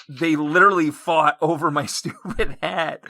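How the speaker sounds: laughing through the words; real laughter creeping into the voice; amused. Real, not acted. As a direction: break into a laugh while telling it. Amused by own hat story.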